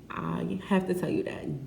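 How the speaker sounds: ordinary delivery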